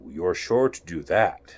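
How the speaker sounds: a lazy grinning cat, unhurried; Cheshire Cat. Acted. Cheshire Cat voiced by reader.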